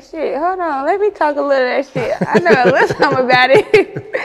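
playful, quick boast breaking into a laugh; laughing brag. Retold or imitated. Rapper telling friends she can rap, laughing. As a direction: brag it fast and laugh through the end. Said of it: "I'm like".

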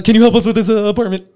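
blurted out high and loud in one nervous rush; the anxious missionary finally spits out his request. Retold or imitated. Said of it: "he just quickly says it".

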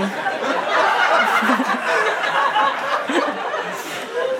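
long high laugh; real laughter. Real, not acted. Laughs at old diary line. (hardop uitlachen)

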